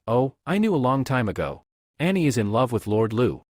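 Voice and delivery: ordinary delivery, nothing marked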